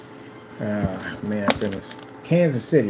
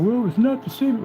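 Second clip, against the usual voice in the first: high-pitched mock complainer; pitched-up mockery of a doubter; mocking naysayer; 11 semitones higher than usual. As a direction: mimic a trash-talker in a high voice. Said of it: "anybody at the end of the day wants to talk a lot of trash".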